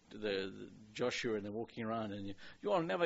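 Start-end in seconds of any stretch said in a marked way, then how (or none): 2.6-3.1 mock French accent, a bit higher; taunting in a hammy French accent. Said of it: "he's speaking in a French accent".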